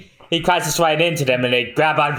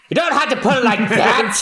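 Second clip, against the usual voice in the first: flustered protest; 9 semitones higher than usual. Acted. Character protests being called lost.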